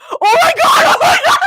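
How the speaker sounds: loud, high excited screaming; shrieking fan freakout; real yelling. Real, not acted. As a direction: scream it, losing your mind. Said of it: "I'm yelling way too much".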